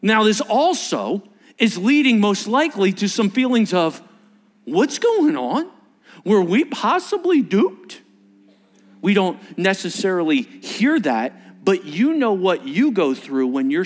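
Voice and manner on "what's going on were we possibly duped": higher, slow incredulous questions; bewildered questioning. Retold or imitated. Disciples doubting what they believed, bewildered. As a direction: ask it slowly, pitched up in disbelief.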